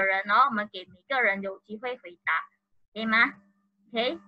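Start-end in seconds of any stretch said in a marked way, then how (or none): none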